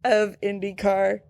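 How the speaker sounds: very slow, spaced-out pronouncement; mock-solemn rule. Stating the number one IndyCar rule, mock-solemn. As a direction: lay down the law word by word, slowly.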